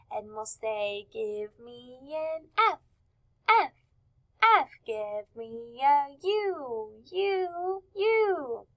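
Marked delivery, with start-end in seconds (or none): 1.1-8.7 slow, drawn-out cheerleader call; spelling cheer. Shown as a demonstration. Teacher leading class in sight-word cheers, spelling cheer. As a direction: lead a letter-by-letter cheer, stretched out. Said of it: "doing it by doing our cheers".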